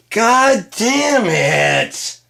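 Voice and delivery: slow, high, loud exclamation; drawn-out shout. Acted. Comic curses at the crowd in a slow, high shout. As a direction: stretch out the curse in frustration.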